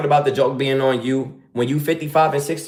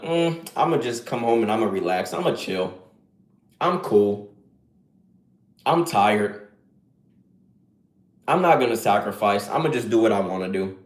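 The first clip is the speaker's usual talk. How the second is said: mock lazy guy; 5 semitones lower than usual. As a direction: lazily mimic a man who just wants to chill. Mocking lazy man's inner voice.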